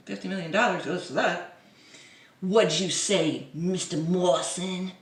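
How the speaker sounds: normal talking voice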